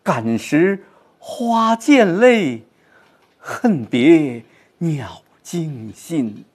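slow, drawn-out recital, 感时 high; 深情慢读; poetry recitation. Shown as a demonstration. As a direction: recite slowly with inner feeling. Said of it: "这种场景作用于你的内心".

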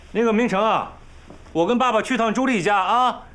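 high-pitched, projected announcement; calling out. Acted. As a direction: call through to him before leaving. Calls out to brother before leaving.

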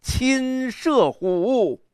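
hugely drawn-out declamation, starting high; 狂放的朗诵. Shown as a demonstration. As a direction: soar high on the first phrase, then land slowly on the second. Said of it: "你看这个狂". (高起慢落，豪放地拉长字音)

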